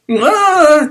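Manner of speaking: high, loud yell; loud shout. Real, not acted. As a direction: belt out the yes. Loud exclamation.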